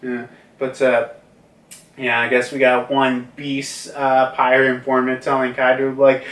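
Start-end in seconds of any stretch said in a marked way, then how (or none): none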